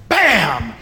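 loud, sudden story sound effect; imitated bang. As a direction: burst it out like a tire blowing. Storyteller imitates a tire blowing out.

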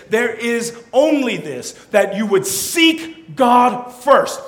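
high, emphatic command, drawn out slowly; imperative command. Acted. Jesus commanding his followers, forceful imperative. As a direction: command it word by word, loudly. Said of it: "He is saying it in imperative form".

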